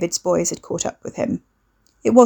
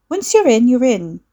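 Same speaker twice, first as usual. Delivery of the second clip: a lightly higher, breezy sister; unconcerned; 5 semitones higher, 5 dB louder than usual. A narrator's reading. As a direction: toss it off, not caring at all. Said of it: "Lucy sounded entirely unconcerned".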